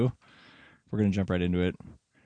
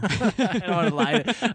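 laughing; 11 semitones higher, 4 dB louder than usual. Real, not acted.

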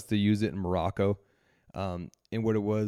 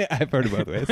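laughing; 4 semitones higher, 5 dB louder, faster than usual. Real, not acted. Joking reply after the Dumbo Drop quip.

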